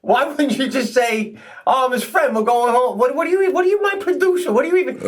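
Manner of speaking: retold rant, climbing high in baffled protest; exasperated scolding. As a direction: scold a pal in high-pitched, comic outrage. Confronting drunk friend over sweater.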